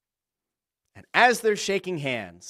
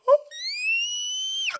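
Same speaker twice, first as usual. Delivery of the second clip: a sudden sharp gasp, pitched way up; big shocked gasp; 3 dB quieter than usual. Retold or imitated. Fan watching Cody answer The Rock, sharp gasp. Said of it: "And you go".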